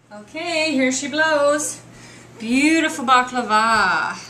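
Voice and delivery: loud, drawn-out proud reveal; showy excitement. Real, not acted. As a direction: present it big, stretching the words out. Showing off the baklava from the oven.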